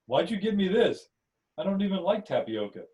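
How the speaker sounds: high, puzzled grumble; annoyed complaint. Acted. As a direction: grumble about the unwanted gift. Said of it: "He frowns and says".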